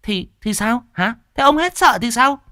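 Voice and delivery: put-on wife daring the drunk husband, high and loud; shrill challenging wife.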